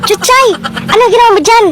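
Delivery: high, eager call across to a friend; excited calling out. Acted. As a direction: shout her name brightly.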